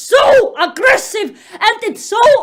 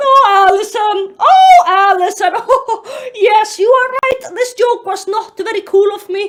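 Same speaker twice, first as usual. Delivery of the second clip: hammed-up swooning; 3 semitones higher, 5 dB louder than usual. Greg praising Allison mid-scene, hammed-up moaning. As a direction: gush in overblown, breathless agreement.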